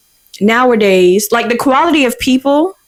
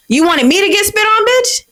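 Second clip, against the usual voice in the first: much higher, incredulous outrage on bitch; indignant rant; 10 semitones higher than usual. Real, not acted. Venting at her manager, higher and outraged. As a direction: pitch climbing into exasperated disbelief.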